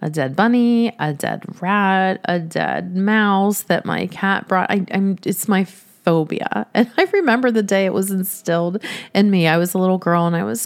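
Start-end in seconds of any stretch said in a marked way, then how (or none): none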